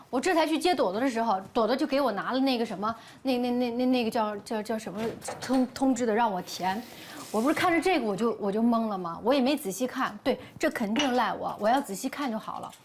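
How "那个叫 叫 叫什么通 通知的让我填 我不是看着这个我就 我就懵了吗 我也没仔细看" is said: normal talking voice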